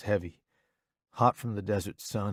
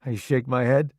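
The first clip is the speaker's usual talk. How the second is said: ordinary delivery, nothing marked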